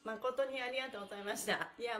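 ordinary delivery

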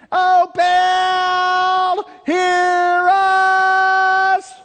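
huge high shout, long and drawn out; calling out to Baal. Acted. Preacher voicing the frantic prophets, a long high shout. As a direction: cry out to a silent god with all you've got.

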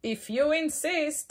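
loud, trumpeting elephant voice. Acted. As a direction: blare it like a big elephant. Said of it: "said the elephant".

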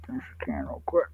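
whispered aside to self; whispering. Real, not acted. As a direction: hush it under your breath. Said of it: "whispered aside".

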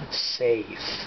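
dry, understated sarcasm on a single word; sarcastic. Real, not acted. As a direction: say it with a touch of sarcasm. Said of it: "said sarcastically".

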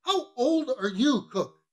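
high, slow, pressing question; insistent questioning. Acted. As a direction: spell it out imperiously. Mate insists on his repeated question.

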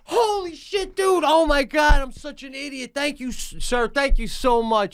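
high, effusive thanks; over-the-top grateful cashier; gushing grateful. Retold or imitated. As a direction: thank him like he saved your life. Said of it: "They should be like".

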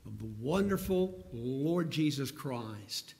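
ordinary talk

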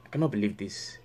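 low, soft grumble; muttered disbelief. Acted. Counselor left waiting on the phone, muttered disbelief. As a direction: say it low, under your breath. Muttering while the call fails.

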